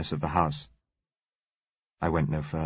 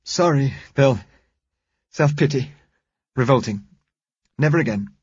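higher, louder, brisk pulling-together; Josella pulling herself together; 6 semitones higher, 5 dB louder than usual. A narrator's reading. Josella shaking off self-pity to Bill, brisk. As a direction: snap herself out of it. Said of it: "she recovered herself".

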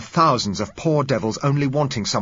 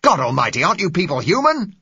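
loud, high, furious outcry; violent passionate plea; 10 semitones higher, 7 dB louder than usual. Acted. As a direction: scream it at them, outraged. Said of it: "The man's voice was violent".

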